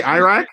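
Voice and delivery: Iraq as "eye-rack", rising in a question; long-I Iraq. Retold or imitated. Checking the friend's way of saying it, questioning long-I Iraq. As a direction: query "Iraq" with an EYE start. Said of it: "You say Iraq?".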